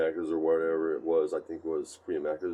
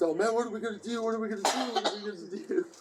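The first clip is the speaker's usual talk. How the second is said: panicked whining; faster than usual. Retold or imitated. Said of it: "So he's just".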